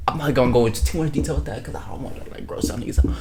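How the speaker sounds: breathy secret whisper; hushed conspiratorial whisper; whispered aside. Real, not acted. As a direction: drop to a whisper, holding back the details. Aside he holds back details.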